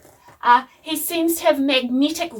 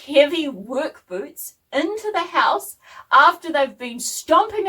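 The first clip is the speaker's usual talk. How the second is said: diplomatic mum; 5 semitones higher, 4 dB louder than usual. Retold or imitated. Mum hinting to Dad about his boots, pointedly diplomatic. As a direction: imitate over-tactful, pointed diplomacy. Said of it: "very diplomatically".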